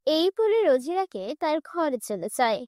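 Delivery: usual speaking voice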